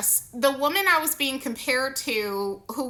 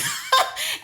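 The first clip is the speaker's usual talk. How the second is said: high disbelieving laugh. Real, not acted. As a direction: burst out laughing. Laughing at the absurd mix-up.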